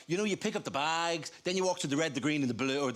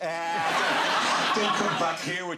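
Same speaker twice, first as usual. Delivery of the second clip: mock angry friend; 5 dB louder, slower than usual. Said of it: "friends who aren't gonna go".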